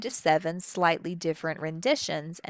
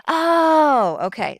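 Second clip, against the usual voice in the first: high, loud mock surprised "oh"; imitating the student getting it at last; sudden realization; 10 semitones higher, 10 dB louder, much slower than usual.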